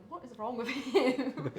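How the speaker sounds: slow, loud, laughing aside; half-laughing bafflement to a friend; laughing disbelief. Retold or imitated. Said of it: "I was looking at Sam and I was like".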